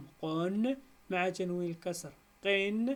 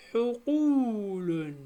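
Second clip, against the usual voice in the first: each syllable stretched slowly and apart; drawn out syllable by syllable for pupils; slow syllable split; 3 semitones higher, 6 dB louder, much slower than usual. Shown as a demonstration. Teacher to pupils, splitting the word, slow and drawn out. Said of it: "نجزئها".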